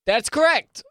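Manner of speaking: bright, loud host announcement; game show host. Acted. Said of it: "I just wanted to sound like a game show host".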